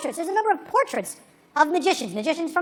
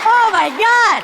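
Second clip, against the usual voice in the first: high, loud, drawn-out cry; mock crowd shout; 7 semitones higher, 7 dB louder, much slower than usual. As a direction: shout it like a frenzied audience. Acting out the screaming crowd.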